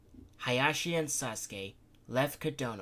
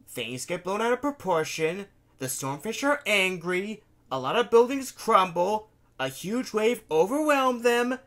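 higher, louder indignant protest; defensive young man; 7 semitones higher, 10 dB louder than usual. Acted. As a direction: argue back, heated and defensive. Daichi's defensive voice.

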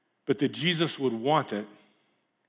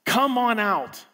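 high, bright encouraging cheer; Jesus eagerly urging Peter out; excited Jesus; 6 semitones higher, much slower than usual. Retold or imitated. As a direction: cheer him on out of the boat. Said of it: "and Jesus says".

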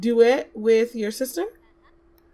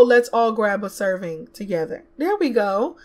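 usual speaking voice

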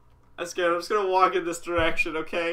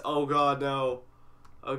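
normal talking voice

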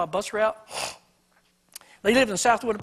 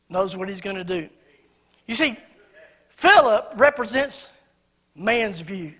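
ordinary delivery

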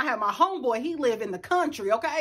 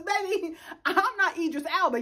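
normal talking voice

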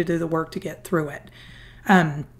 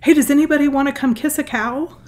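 put-on higher, louder eager child calling out; lightly voiced little daughter, eager; excited little girl; 8 semitones higher, 4 dB louder than usual. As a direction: call out like an excited nine-year-old. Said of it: "my little daughter comes running in the room".

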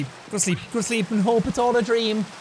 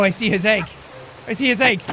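usual speaking voice

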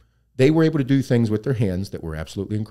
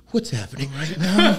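laughing disbelief; 7 semitones higher than usual. Retold or imitated. Retold confused teen, laughing.

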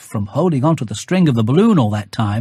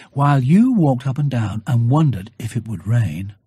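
ordinary delivery, nothing marked

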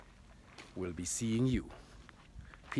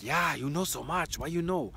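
higher, mock-amazed Japanese accent; imitating Japanese lady; 7 semitones higher, 4 dB louder than usual. Restaurant lady marvelling at the reading, lightly mimicked in Japanese accent. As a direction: echo her wonder in a put-on accent.

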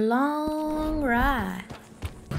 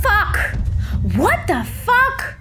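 sharp loud high curse; real frustrated outburst; 9 semitones higher, 4 dB louder, slower than usual. Real, not acted. Streamer reacting to losing his legendary pelt, angry outburst. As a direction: blurt it out in disbelief.

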